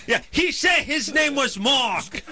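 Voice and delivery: high, slow caricature of a phone clerk; shrieky, drawn-out insistence; restaurant worker. Acted. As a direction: whine it slowly at high pitch.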